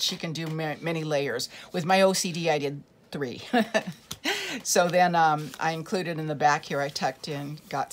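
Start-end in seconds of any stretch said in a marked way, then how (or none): 3.5-4.6 a quick high laugh at herself; brief self-mocking giggle; short laugh. Real, not acted. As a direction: laugh it off lightly. Laughs at her own OCD.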